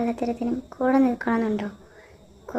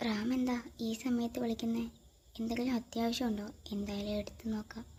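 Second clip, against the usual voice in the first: much softer, hushed voice; 2 semitones lower, 11 dB quieter than usual. Acted. A girl wondering about a late call from Ram, hushed and subdued.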